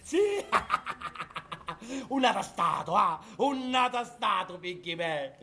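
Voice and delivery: high cackling madman; manic laughing. Acted. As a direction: cackle over the treasure. Mad laughter over the casket.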